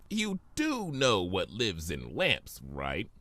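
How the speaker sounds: lightly voiced whiny trickster; Discord. Discord in the lamp whining at Fluttershy, lightly voiced. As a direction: whine impatiently from inside the lamp.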